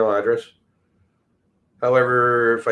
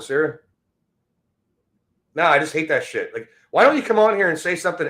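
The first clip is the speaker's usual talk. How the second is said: normal talking voice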